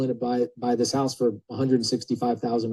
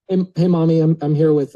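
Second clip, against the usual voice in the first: put-on little boy calling mommy; 4 semitones higher, 6 dB louder than usual. Grown man calling his mommy.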